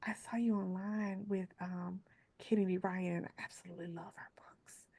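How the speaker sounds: soft, timid whisper; hushed, bashful confession to a coworker; shy whisper. Retold or imitated. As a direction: whisper it shyly, afraid to intrude. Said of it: "she was so sweet and very shy".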